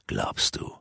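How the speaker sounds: low, hushed villain relishing his secret; scheming sorcerer. Acted. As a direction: savour every word like a villain confiding his plot. Rurak's villain voice.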